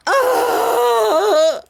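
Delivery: loud, high drawn-out wail; exaggerated crying "aah", high and sustained; mock wail. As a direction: burst into a hammed-up bawling cry. Retold burst of crying at remark.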